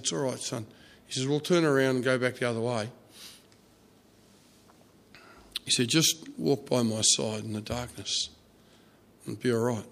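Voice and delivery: put-on calm a scared child quietly; gentle reassurance. Father calming his frightened son in the dark, soft and reassuring.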